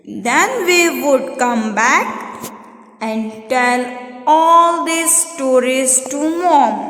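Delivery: slow, measured recitation for a class; poem reading. Shown as a demonstration. Teacher reads the poem to students, slow careful recitation. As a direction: read it out line by line, drawing the words out slowly. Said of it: "I am going to read this poem".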